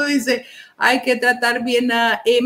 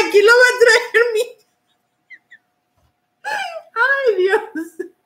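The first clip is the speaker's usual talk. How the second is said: laughing hard; 11 semitones higher than usual. Real, not acted. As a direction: speak while helpless with laughter. Laughing fit while reading comments.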